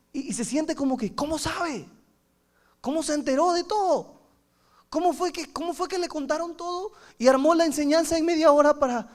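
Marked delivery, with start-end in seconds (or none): 1.1-9.1 high-pitched, incredulous questions; mock astonished, pitched up; acted bewilderment. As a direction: wonder aloud as a churchgoer who feels exposed. Acting a listener who feels exposed.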